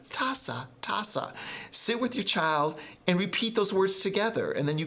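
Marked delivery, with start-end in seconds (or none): 0.1-1.3 "Taza" said twice, the second whispered higher; native pronunciation. Shown as a demonstration. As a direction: model "taza" like a native speaker, then whisper it. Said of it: "you hear a native speaker go".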